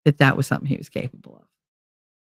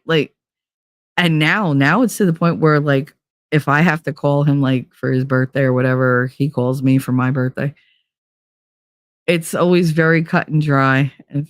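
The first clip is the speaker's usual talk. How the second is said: ordinary talk, nothing marked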